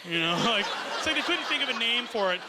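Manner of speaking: ordinary talk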